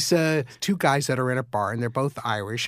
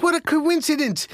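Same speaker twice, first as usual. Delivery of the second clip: higher, amazed Irish voice; excited Irish accent; 10 semitones higher than usual. Retold or imitated. Second Irishman marvelling at the coincidence in a high Irish voice. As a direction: marvel at it in a brogue. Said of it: "identify with the accent".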